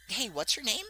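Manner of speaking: light high princess voice; high Daisy voice. Acted. Daisy asking a new racer his name, high and sweet.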